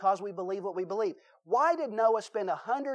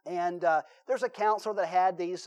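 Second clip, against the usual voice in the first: ordinary talk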